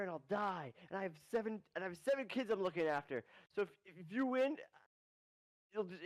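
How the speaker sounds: high, dramatic anime-rival voice; Seto Kaiba. Acted. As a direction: ham up the cocky duelist threatening doom. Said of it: "he pulls a Seto Kaiba".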